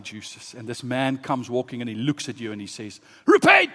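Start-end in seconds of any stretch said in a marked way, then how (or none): 3.3-3.7 high, loud one-word command; sudden prophet's shout. Acted. Man from the sea confronts you, shouting.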